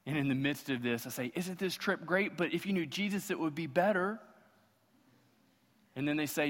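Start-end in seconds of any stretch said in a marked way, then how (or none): 1.3-4.2 higher-pitched, mock-eager pitch; hopeful coaxing. Believer pitching Jesus to a friend on a trip, hopeful coaxing. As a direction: act out a hopeful, leading sales pitch. Pastor acts out coaxing the lost.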